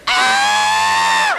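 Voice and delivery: very high shrill mock scream of a mother; mother shrieking at her son picking his nose. Mother goes crazy.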